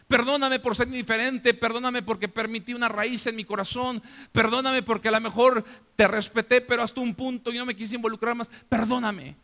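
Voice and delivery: urgent appeal, pushing each repeated plea; impassioned plea. Acted. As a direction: plead with your father, pitched high. Acted plea to his father.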